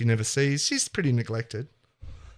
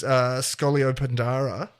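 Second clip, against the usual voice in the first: ordinary talk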